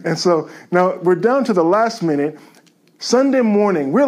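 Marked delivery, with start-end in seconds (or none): none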